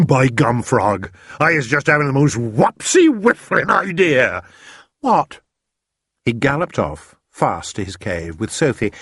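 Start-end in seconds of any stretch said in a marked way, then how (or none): 0.1-4.4 higher, louder giant voice with a chuckle; a giant bursting with a new idea; BFG excited. A narrator's reading. BFG to Sophie, delighted with his idea, excited. 5.0-5.4 put-on much higher, louder girl's question; a disappointed girl asking; Sophie asking. A narrator's reading. Sophie to the BFG, asking if they're done.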